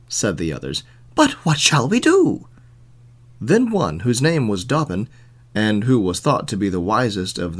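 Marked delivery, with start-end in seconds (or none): 1.1-2.5 high, pleading question; townsmen asking. A narrator's reading. Townsmen asking each other for a plan, high-pitched. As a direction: lift the voice well up.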